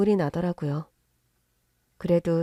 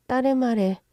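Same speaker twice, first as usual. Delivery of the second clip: ordinary delivery, nothing marked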